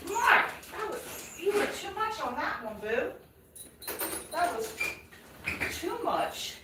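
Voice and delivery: high mock scolding, held back; playful telling-off to the pet; teasing the dog. Real, not acted. Owner teasing her dog Mark after his fuss, mock scolding.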